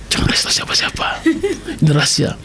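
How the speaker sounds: whispered aside, don't let anyone hear; playful conspiratorial whisper; secret whisper. Acted. Confiding a secret to viewers.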